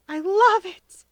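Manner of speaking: put-on high, loud childish cry of joy; delighted child shouting. A narrator's reading. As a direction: burst out with glee.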